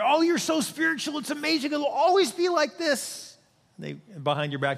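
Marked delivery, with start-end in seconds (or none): 0.0-3.4 high, syrupy flattering whisper-voice; flattering demon. Acted. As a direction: coo false praise like a tempting demon. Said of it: "the demon will whisper in your ear".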